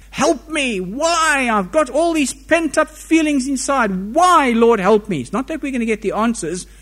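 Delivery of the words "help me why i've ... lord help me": higher, urgent begging; reaching out to God in distress; pleading prayer. Acted. Voicing a prayer of lament.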